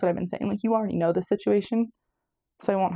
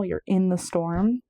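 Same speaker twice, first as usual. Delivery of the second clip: normal talking voice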